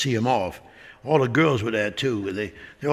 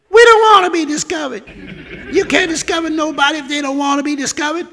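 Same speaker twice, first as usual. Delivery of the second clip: put-on high, sassy girl's voice; 12 semitones higher, 3 dB louder than usual. Girl on the beach sassing Chris from shore, in a high sassy voice. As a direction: talk back like a bold young girl. Indian girl refusing, defiant.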